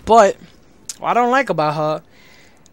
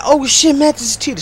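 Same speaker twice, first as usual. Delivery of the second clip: mock accusers; 8 semitones higher, 5 dB louder than usual. Voicing viewers' accusation.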